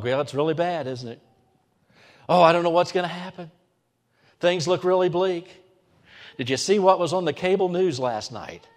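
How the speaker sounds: fretful, anxious mock gossip; worried churchgoer. Preacher mimics a worried churchgoer, fretful. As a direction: voice the hand-wringing believer. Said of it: "we insert into our vocabulary".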